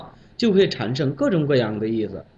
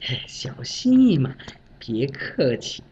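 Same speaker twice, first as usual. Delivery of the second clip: humble subordinate. Retold or imitated. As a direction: brush it off modestly. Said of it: "小张说：".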